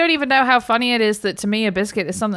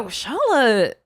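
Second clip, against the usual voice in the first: impressed wow; 6 semitones higher, slower than usual. Real, not acted. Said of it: "reacting to joke".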